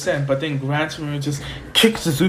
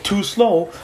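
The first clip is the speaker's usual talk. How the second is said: mock taunt, pitched up; 6 semitones higher than usual. Gran Torino dodging Izuku, taunting. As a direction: tease him with a higher voice.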